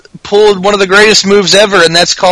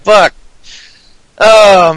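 ordinary talk

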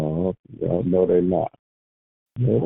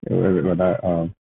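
normal talking voice

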